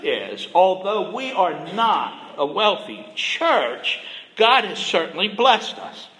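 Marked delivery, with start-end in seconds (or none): none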